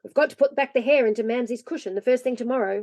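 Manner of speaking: ordinary delivery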